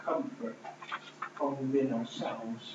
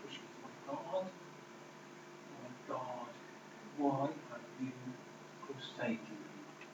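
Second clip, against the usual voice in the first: far softer, breathy whisper of despair; anguished cry, hushed; 4 semitones lower, 15 dB quieter than usual. Retold or imitated. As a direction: let the cry collapse into a whisper. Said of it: "Jesus cried out in a loud voice".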